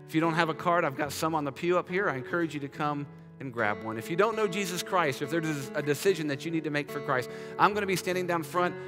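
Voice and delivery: usual speaking voice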